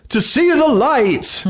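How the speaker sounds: slow, high ritual reply; solemn ritual answer. Retold or imitated. Initiate gives the ritual answer, slow and high. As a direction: intone it like a scripted vow.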